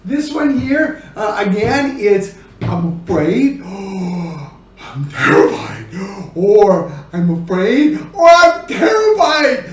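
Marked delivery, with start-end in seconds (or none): 2.6-5.9 low, gasping, slow terror; gasp, then draw out the fear under your breath; hushed fear. Shown as a demonstration. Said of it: "the expressions gotta change". 7.1-9.6 escalating fear. Shown as a demonstration. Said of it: "the expressions gotta change".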